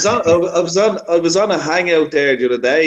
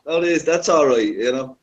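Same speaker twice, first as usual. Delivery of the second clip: normal talking voice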